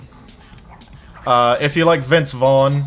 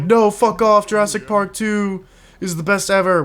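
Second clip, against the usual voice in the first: angry commenter; 8 semitones higher than usual. Retold or imitated. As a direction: rant like an offended fanboy. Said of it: "someone in the comments being like".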